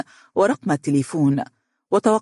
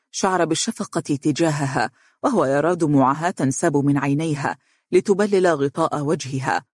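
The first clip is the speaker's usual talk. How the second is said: ordinary delivery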